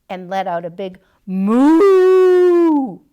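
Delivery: long, loud lowing moo; big moo. Shown as a demonstration. Mister Mutt showing the dog how to moo. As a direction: moo like a cow.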